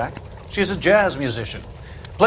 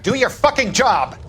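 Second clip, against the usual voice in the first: angry order; 8 semitones higher than usual. Acted. Boss berating his investigator, angry and raised. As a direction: lash out at him to do his job. Boss loses temper at Jack.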